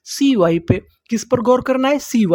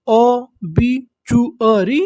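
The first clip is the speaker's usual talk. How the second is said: ओबीचुअरी drawn out slowly, syllable by syllable; much slower than usual. Shown as a demonstration. Teacher spells the word out slowly for students. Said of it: "तो देखिए हमारी meaning क्या बनी".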